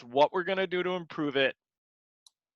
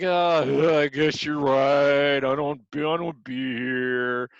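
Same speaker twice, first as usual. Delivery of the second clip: lower, slow, defeated mumble; mock dejected trainee voice; 3 semitones lower, 4 dB louder, slower than usual. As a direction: sound beaten down and ready to quit. Trainee answers the put-down, dejected.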